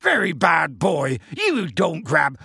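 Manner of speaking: slow, emphatic scolding; Hagrid yelling. Acted. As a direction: hammer each word at a naughty giant. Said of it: "Harry heard Hagrid yelling".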